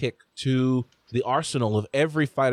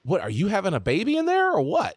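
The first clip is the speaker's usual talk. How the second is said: lightly voiced wife, higher-pitched question; imitated wife; 7 semitones higher than usual. Wife asks husband about his moaning, lightly imitated wife. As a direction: imitate an incredulous wife calling out. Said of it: "my wife is like".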